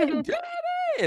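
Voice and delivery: put-on very high kid's wail for mom and dad; child calling parents. Dad voicing his kid calling for mommy and daddy. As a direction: cry out for the parents like a small kid.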